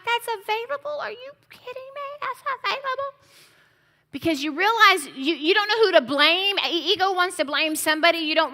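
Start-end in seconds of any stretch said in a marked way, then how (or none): 0.0-3.2 high, incredulous disbelief; remembering pacing the room in disbelief; retold outrage. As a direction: play your past self stunned it was possible all along. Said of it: "I'm like".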